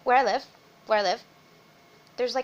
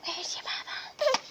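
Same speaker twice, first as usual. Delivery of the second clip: soft, breathy, much higher and slow; 7 dB quieter than usual. Real, not acted. Mother softly asks her baby for the bottle, hushed.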